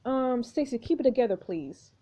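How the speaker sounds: ordinary delivery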